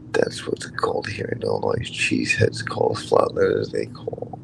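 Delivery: hushed whispered aside. Real, not acted. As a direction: breathe the words, no voice.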